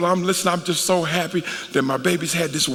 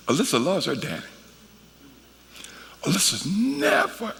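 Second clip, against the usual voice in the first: usual speaking voice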